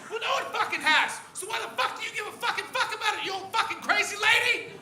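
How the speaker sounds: high loud exasperated rant; imagined rant. Pretend. Comic imagining raging at the old woman, loud high rant. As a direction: let rip at her.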